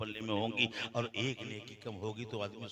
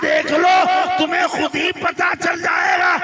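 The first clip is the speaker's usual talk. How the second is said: booming high shouted sermon; fiery preaching; 21 dB louder, slower than usual. Acted. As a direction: preach it at full force. Preaching at full force.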